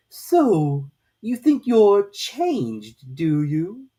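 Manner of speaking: very slow, loud, strange drawn-out voice; slow caterpillar drawl. A narrator's reading. Caterpillar lazily questioning Alice, very slow and loud. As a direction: stretch every word lazily, from on high.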